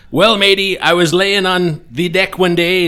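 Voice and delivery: loud, high pirate bellow; booming pirate voice. Acted. Pirate telling the insurance agent how he lost his leg, booming. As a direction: boom it like an old sea pirate. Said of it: "the accent".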